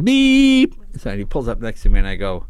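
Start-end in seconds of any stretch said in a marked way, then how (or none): 0.0-0.7 long, high, loud beep; sustained honk at a raised pitch; imitated car horn. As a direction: mimic a driver leaning on the horn. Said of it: "this guy goes".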